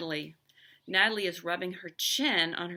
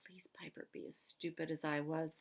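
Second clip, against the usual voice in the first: much softer whispered plea; inner whisper; 7 dB quieter than usual. A narrator's reading. Boy silently begging Piper not to understand, whispered. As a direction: beg under your breath.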